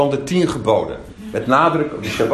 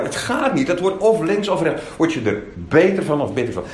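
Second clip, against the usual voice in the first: ordinary talk, nothing marked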